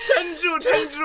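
squealing with laughter; high laughing plea. Real, not acted. Friend cracking up, urging on.

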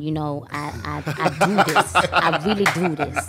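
put-on American rapper accent; mock American hip-hop accent. Shown as a demonstration. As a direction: talk like a swaggering American rapper. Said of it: "talk in an American accent".

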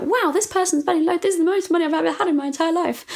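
put-on a young artist gushing over the big sale; amazed excitement. Artist marvels at a buyer spending so much, excited and quick.

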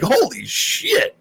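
very high, slow, drawn-out amazement; stunned. Retold or imitated. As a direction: stretch it out in awe. Watching the beating, amazed.

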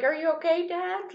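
put-on soft, high child voice; concerned little kid. Kid checking on his crying dad, concerned little voice. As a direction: ask it small, high and quiet. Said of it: "he's like".

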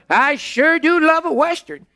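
put-on high, folksy old codger; old prospector. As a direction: cackle it like an old gold miner. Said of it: "he talked in that funny way".

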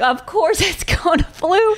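high, slow, tender "of course"; tender joy. Retold or imitated. Mother answering her husband at the birth, tender and high.

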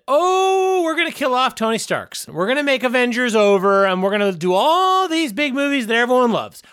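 hammed-up studio promise, mockingly high; mock studio quote. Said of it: "You said".

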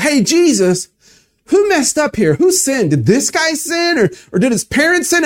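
disciples questioning. Acted. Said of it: "they talk to this man".

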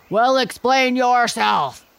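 a fierce beast demanding answers; fierce gorilla voice. A narrator's reading. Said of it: "said the gorilla".

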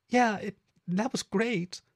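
halting, high-pitched reassurance; Meadow, hesitant. Acted. Meadow covers her urge to cry, hesitant. Hides tears, answers lover.

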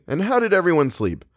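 high, bright question. A narrator's reading. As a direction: ask the room cheerily. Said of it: "Luke said brightly".

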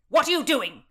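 loud, high, sudden demand; angry snap. A narrator's reading. Countess catching Constance with her book, snapping angrily. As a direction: burst out at someone caught in the act. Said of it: "snapped the Countess out of nowhere".

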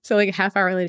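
ordinary delivery

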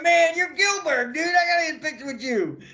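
high, starstruck gushing; fanboy over him; starstruck fans. Retold or imitated. Young wrestlers meeting their hero, starstruck. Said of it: "They go".

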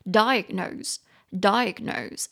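diagnose drawn out very slowly, stress on the first syllable DI; slow pronunciation demo. Shown as a demonstration. Teacher modelling diagnose for students, slow and carefully stressed. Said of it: "重音节加高的音节在低音节".

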